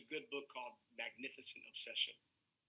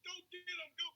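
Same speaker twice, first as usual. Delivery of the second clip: high, squeaky panicked voice pushing someone forward; a frightened person shoving their partner at a burglar; scared partner pushing. Retold or imitated. Coward hiding behind partner, squealing much higher "go get him". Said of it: "They're like".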